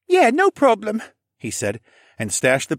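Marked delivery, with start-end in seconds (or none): 0.1-1.0 put-on high, loud amazed boy voice; can't believe it; surprised boy. Boy amazed Alex kept the pendant, high and loud. Rylan's voice.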